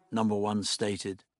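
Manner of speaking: ordinary delivery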